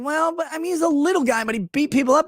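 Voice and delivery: mock them in a high voice; a hint of mocking falsetto. Mock voice of people praising McGregor.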